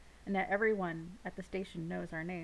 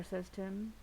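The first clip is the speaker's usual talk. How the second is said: plain reading voice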